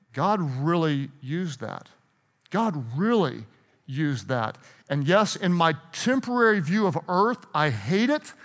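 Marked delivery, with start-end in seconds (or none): 0.1-4.6 soft, low, slow awe; quiet reverence. Acted. Person looking back on a loss, quiet reverence. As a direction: let it sink in gently. Grateful hindsight voiced quietly.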